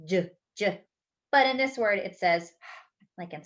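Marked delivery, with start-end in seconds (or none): 0.1-0.8 "j, j," as two short voiced j sounds, as in jam; j letter sound. Shown as a demonstration. Teacher sounding out the usual j sound for children. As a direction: tap out "j, j," like the start of jump. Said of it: "J usually says".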